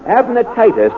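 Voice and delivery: usual speaking voice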